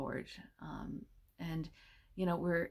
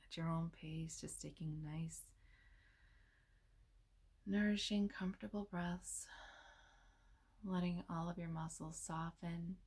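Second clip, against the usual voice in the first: soft, unhurried meditation voice with long pauses; hushed, soothing delivery, leaving space between phrases; guided meditation; 6 dB quieter than usual. Real, not acted.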